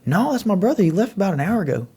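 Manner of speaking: high, offhand put-on voice of the brother; casually brushing it off, lightly voiced; mock nonchalant. Retelling the guy at the door, mock nonchalant. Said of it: "Real nonchalant".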